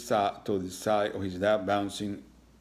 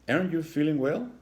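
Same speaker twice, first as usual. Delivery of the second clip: high, worried motherly ask; mother's concern; 8 semitones higher, 4 dB louder than usual. Acted. Mother checking on her son, higher and concerned. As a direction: lift it up like a fretting mom.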